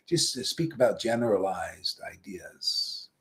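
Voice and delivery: whispered, mocking the vague talk. Guru mocking impersonalists in a whisper. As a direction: breathe it out as a mock secret.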